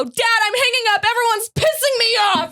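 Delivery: high, fed-up outburst; retold exasperation. Daughter hanging up on dad, retold in high exasperation. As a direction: act out snapping at a parent.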